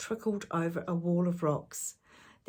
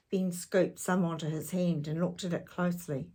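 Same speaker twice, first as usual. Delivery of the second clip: usual speaking voice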